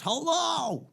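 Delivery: very high mock hello; exasperated answering. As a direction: answer the phone at the end of your patience. Harassed man answers final call.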